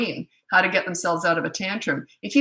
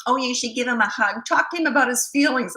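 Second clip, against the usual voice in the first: higher, sweet advice-giving tone, a touch mocking; lightly voiced young moms; 4 semitones higher than usual. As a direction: imitate a well-meaning young mom. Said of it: "all these young moms kept coming over".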